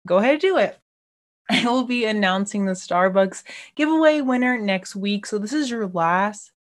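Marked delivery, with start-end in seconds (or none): 0.1-0.8 playful urging. Acted. Urging listeners to tag her.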